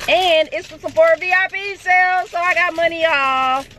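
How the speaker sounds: high-pitched gleeful brag; gleeful celebration. Real, not acted. Celebrates a sale and money off.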